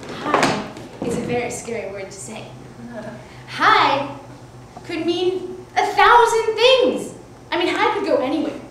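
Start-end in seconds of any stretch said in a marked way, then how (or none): none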